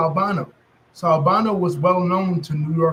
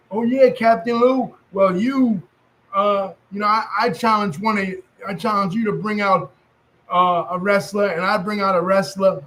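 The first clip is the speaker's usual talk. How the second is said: put-on New York accent; 4 semitones higher than usual. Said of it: "I'm using my New York accent".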